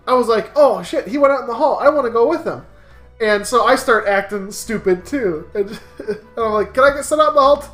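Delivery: usual speaking voice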